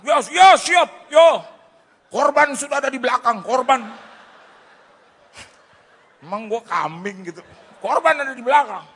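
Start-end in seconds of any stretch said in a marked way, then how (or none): 0.1-3.8 high, booming phone shout over engine noise. Retold or imitated. Driver on the phone while riding, high loud shouting. 7.8-8.9 high, loud mocking shout; mimicked phone shout. Passenger mimicking driver's report of him, high mock shout.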